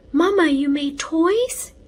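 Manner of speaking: put-on high, loud little boy voice, wide-eyed; a small child, astonished; high child voice full of wonder; amazed little boy. A narrator's reading.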